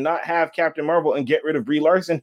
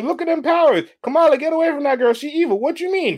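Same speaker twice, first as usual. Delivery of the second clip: Rogue voice; 9 semitones higher than usual. Acted. Acting out Rogue and Kamala.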